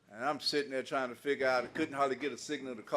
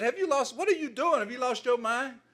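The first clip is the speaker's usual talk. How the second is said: shocked outburst; 9 semitones higher than usual. Retold or imitated. Wife catches husband wrecking things.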